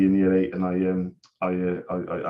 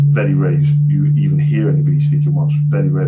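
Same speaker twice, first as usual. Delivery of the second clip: emphatic rant; 12 dB louder than usual. Real, not acted. Ranting about Welsh in Cardiff.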